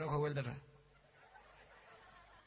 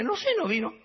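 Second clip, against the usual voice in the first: pitched way up, a friend's casual answer; high squeaky reply; 11 semitones higher, 6 dB louder than usual. Acted. Friend shrugs off the question in a high voice. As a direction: answer in a much higher put-on voice.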